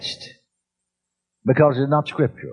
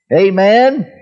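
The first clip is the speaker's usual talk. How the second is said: laughing amen; 5 semitones higher, 6 dB louder than usual. Real, not acted.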